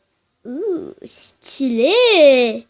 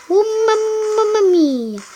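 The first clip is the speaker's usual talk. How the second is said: higher, slow mock Italian exclamation; 6 semitones higher, slower than usual. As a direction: play a cartoon Italian. Réaction italienne jouée. (mamma mia à l'italienne, aigu et lent)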